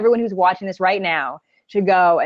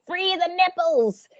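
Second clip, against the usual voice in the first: mock slogan; 9 semitones higher, 4 dB quieter, slower than usual. Mock slogan after "I'm like.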